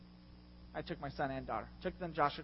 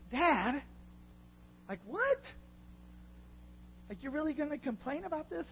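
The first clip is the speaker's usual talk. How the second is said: put-on higher, disbelieving kid voice; a son who can't believe his dad; incredulous son; 9 semitones higher than usual. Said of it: "my son just like".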